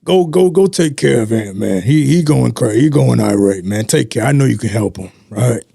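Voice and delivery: lightly voiced big boss, urgent; a hint of a heavy-set man sending him off; imitating Jerome. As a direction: coworker voicing his supervisor's request. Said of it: "Deep voice".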